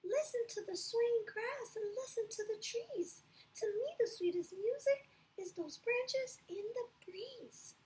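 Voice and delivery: high, gentle little cricket voice. Acted. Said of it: "coughed the cricket".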